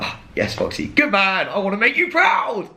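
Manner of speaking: high, put-on eager schoolboy voice; eager recruit. Imitating himself answering Foxy, high eager voice. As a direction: beam up at the boss, desperate to please. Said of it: "I'm like".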